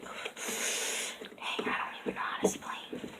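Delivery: breathy whisper, lost for words; whispered aside. Real, not acted. Describing the slime to viewers, trailing into a whisper. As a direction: drop to a whisper, giving up explaining.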